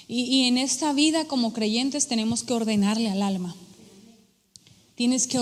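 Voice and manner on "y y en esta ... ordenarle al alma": ordinary talk, nothing marked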